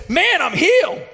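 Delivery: high, bright-eyed mock exclamation; a burst of retold joy; excited. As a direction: retell his amazed outburst. Brother-in-law amazed at healing.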